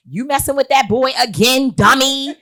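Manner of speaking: high, loud mocking mom; playful impression of mom scolding; mother scolding. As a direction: scold like a sharp-tongued mother. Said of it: "that's my mother".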